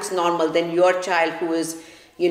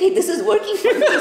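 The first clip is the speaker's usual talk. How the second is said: mock gleeful child; 13 semitones higher, 3 dB louder than usual. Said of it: "then they're like".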